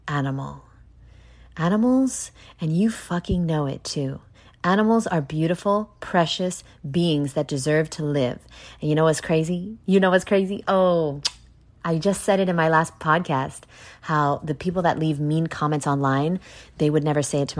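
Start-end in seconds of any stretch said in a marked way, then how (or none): none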